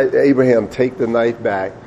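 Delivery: usual speaking voice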